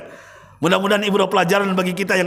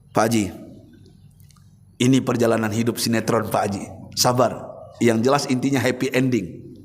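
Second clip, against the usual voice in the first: reassuring ustadz, hushed; consoling; 8 semitones lower than usual. Retold or imitated. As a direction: soothe the grieving old man gently. Ustadz consoling the weeping man.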